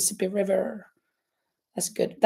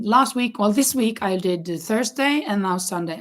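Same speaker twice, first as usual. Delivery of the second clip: ordinary delivery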